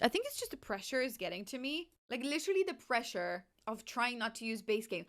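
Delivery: ordinary talk, nothing marked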